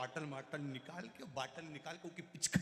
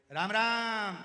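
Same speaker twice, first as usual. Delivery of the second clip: shouted ram ram, louder and higher; loud greeting; 6 semitones higher, 11 dB louder, much slower than usual. Acted. Deaf visitor shouting his greeting to the sick patient. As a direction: bellow the greeting like a deaf man.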